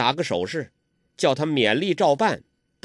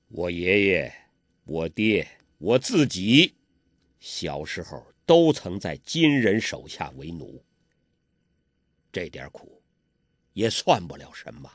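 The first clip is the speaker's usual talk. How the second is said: put-on weary old master; 7 semitones lower than usual. A narrator's reading. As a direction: urge them to flee, low and tired. Old master recalls slavery.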